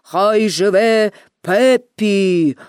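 low, long-drawn ceremonial hail; fire chief's toast. A narrator's reading. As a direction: proclaim it slowly like an official. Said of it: "запропонував начальник пожежників".

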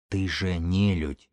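soft, slow, drawn-out accusation; quiet realisation. A narrator's reading. Widow stares at Белка in recognition, speaking softly. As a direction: let it out slowly under the voice. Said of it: "неожиданно тихо сказала Эва".

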